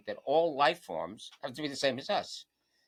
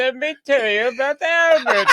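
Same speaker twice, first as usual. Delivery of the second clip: put-on Romanian accent, much higher and louder; 13 semitones higher, 12 dB louder than usual. Romanian accent, repeated on request for laughing friends. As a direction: redo the teacher's Romanian accent, bigger. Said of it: "say it again".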